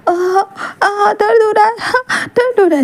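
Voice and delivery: high, sobbing labour cries; crying in pain. Acted. Wing crying to Wangji in labour, high sobbing wail. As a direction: wail through the contractions.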